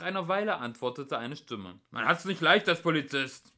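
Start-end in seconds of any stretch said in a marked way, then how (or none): 1.9-3.5 loud, raised lament; shouted grumble from a distance; called-out answer. A narrator's reading. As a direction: call it across to them, higher and louder. Policeman shouts back from afar.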